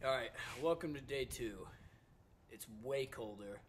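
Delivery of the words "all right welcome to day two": normal talking voice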